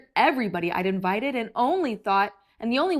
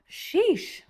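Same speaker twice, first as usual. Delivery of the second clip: exasperated sheesh; 10 semitones higher than usual. Acted. Wrong stop exasperation.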